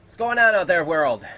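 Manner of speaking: very loud, high-pitched hail; big booming shout-out to viewers; shouted greeting. Pretend. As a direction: holler hello to the whole world. Hailing the stream audience.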